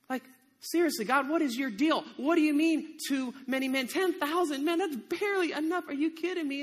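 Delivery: high, incredulous complaint; exasperated. Acted. Gideon told he still has too many men, exasperated. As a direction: argue back, pitch climbing in disbelief. Gideon argues with God again.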